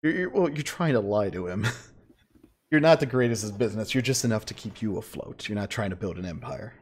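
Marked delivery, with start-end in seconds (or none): none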